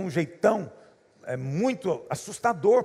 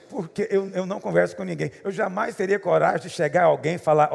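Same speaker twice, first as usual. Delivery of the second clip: normal talking voice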